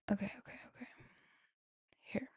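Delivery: whispered self-talk while searching; under her breath. Real, not acted. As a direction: softly whisper it to yourself. Searching messages, talking to herself.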